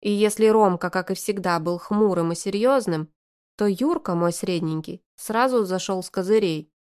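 ordinary delivery, nothing marked

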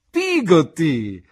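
loud, drawn-out call of her name. Acted. The boy finally reveals himself to his nurse, calling out loud. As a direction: cry her name slowly across the doorway. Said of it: "le gridai".

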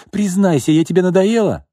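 fiancée demanding a confession, hysterical. A narrator's reading. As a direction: shriek the question at him. Hysterical quarrel continues.

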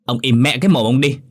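acted rude outburst; angry cursing. A narrator's reading. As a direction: curse him into silence. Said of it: "Hương cong môi chửi tục".

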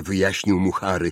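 usual speaking voice

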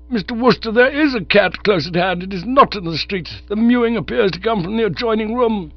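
put-on startled and formal, like a fussy old doctor; alarmed pompous old man. Sir Roderick warns Bertie.

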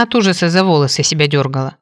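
ordinary delivery

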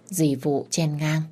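usual speaking voice

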